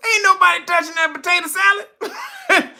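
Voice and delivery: very high, laughing insistence; cracking up while saying it. Real, not acted. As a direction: squeal it out through laughter. Comic repeat through laughter.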